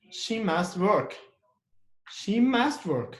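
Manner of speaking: slow, with "must" stressed and "work" falling. Shown as a demonstration. Teacher demonstrating stress on must, slow and emphatic "must". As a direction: insist on "must", word by word. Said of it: "So we put stress on must".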